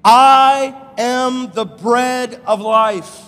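I am the bread of life, drawn out slow and loud; slow emphatic declaration. Shown as a demonstration. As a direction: preach it out slowly, every word weighted. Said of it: "read these first words with me".

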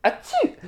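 very high, tiny achoo; small rodent's fake sneeze; mock sneeze. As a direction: squeak out a pretend sneeze. Imitating the rat's sneeze.

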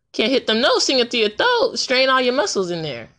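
normal talking voice

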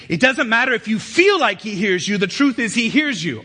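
full-force preaching. Acted. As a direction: drive each phrase home, high and loud.

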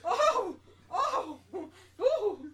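loud high startled "oh, oh, ooh"; startled gasping. Retold or imitated. Woman recoiling from the counter guy, loud mock gasps. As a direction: jump back in mock shock. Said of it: "I just was like".

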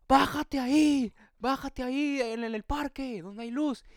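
high, slow holler, loud at first then easing; imitated shout. Stranger telling a lost kid where to get off, imitated shout. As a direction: call out instructions across a distance. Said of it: "un señor me grita al fondo".

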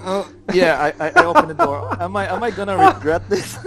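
high, nervous laughing. Real, not acted. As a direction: laugh through the words. Laughing while opening door.

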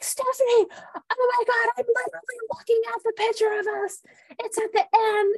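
imitating a friend who just spotted the photo, excited; excited retelling. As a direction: gush it out like you can't believe it. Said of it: "And you're like".

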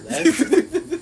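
high, slow laughing line. Real, not acted. Recalling the beer can hit, laughing. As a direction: laugh through it.

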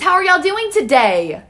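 mock server greeting. Server mimicking her own greeting to a table, chirpy and loud. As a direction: put on the chirpy waiter act.

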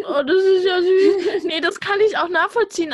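high, loud, laughing coo of delight; gushing, half-laughing aww; touched delight. Real, not acted. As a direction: melt at something sweet. Touched by friend's hometown story.

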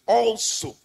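"also" set apart and lifted; stress on also. Shown as a demonstration. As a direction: hit the word "also" alone. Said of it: "I want that emphasis".

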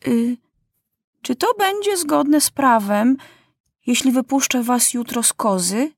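put-on high, childish, careful question; King Maciuś questioning the justice minister; boy king's voice. A narrator's reading. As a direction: ask politely like a boy king. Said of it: "i zapytał".

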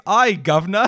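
teasing a friend's bad British accent; mock British accent. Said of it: "all you say is".

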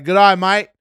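loud, high mock Australian accent on "Good eye, mate". Retelling the Australian fighter's greeting, loud mock Australian accent. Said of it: "he's got the craziest accent".

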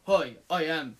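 stiff upper lip; flat, stoic hero voice, lightly done; stoic Big Daddy. Retold or imitated. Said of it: "he talks, like, very stoic".

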